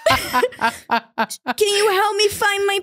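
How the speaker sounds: put-on high, slow, helpless girly voice with laughs; breathy-free high mock damsel, giggling through it; ditzy helpless woman bit. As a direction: play the clueless damsel asking for help. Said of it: "I'll do this bit with Dave where I'm like".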